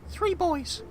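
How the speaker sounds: high, soft timid servant voice; a meek servant delivering bad news; nervous servant. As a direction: servant telling master his wife died, timid and soft.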